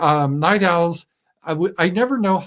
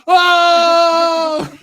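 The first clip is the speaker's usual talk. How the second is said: huge high, drawn-out holler; play-acted amazed shout; 11 dB louder than usual. Host acting like a hype streamer, play-acted amazed shout. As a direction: scream it out in mock amazement. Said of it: "we'll act like".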